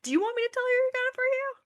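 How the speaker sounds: high, eager gushing; bubbly, excited gratitude; excited thanks. Acted. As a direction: gush with excitement, voice lifting at the end. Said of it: "takes it with excitement".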